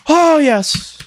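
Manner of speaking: high, loud yelp of triumph; excited cry. Real, not acted. Gamer survives a tense moment, high yelp. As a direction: let it burst out.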